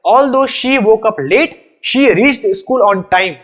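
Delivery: very slow, word-by-word reading of the test sentence; deliberate slow dictation, no yet; slow quiz sentence. Shown as a demonstration. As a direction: read the sentence out slowly for students to judge. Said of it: "बता दो next वाला सही है या गलत है".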